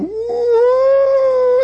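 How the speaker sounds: high, loud siren woo; long high siren wail; siren sound. Retold or imitated. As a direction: imitate a distant siren. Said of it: "they hear the siren".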